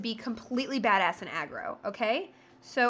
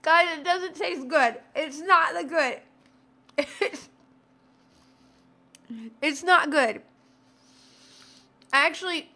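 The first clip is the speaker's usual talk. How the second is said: after eating wasabi; 4 semitones higher than usual. Real, not acted. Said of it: "It's wasabi that's hitting me".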